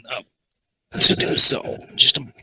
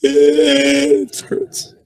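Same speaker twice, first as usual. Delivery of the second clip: laughing in pain; 4 semitones higher, 5 dB louder than usual. Real, not acted. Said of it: "actually starting to tear up".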